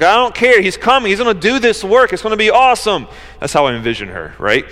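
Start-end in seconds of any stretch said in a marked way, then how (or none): 0.0-3.1 defiant excitement. Pretend. Mary answering the worried people, bold excited reply. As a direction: toss off the warning, thrilled he's coming. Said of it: "And she's like".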